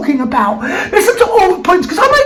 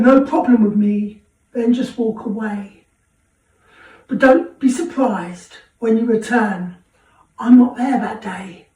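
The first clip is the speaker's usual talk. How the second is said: poem recital; 6 semitones lower, 5 dB quieter than usual. Acted. Host closes the show reading a local poem, soft and solemn. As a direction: recite the poem quietly and earnestly.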